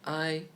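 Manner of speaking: I held high and level, first tone. Shown as a demonstration. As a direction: sing I on a high flat pitch. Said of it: "For tones".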